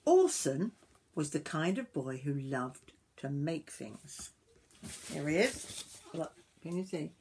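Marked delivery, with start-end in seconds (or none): 0.1-4.3 lively, sing-song storybook reading; story narration to children. A narrator's reading. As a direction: read it aloud to young children.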